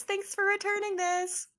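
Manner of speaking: high, light grateful voice; Clarissa voice. Acted. Clarissa thanking Anderson for returning the book, high and sweet. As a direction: chirp it sweetly like a kind stranger. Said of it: "she goes".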